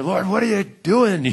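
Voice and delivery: exasperated prayer. Acted. Acted exasperated prayer of prophet.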